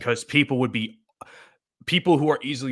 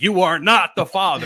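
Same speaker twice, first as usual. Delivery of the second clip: loud host verdict, NOT leaned on; Maury voice; 6 semitones higher, 7 dB louder than usual. Retold or imitated. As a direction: announce it like a talk show host. Maury quote impression.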